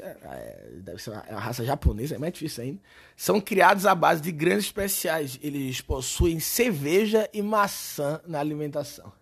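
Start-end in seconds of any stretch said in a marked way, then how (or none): none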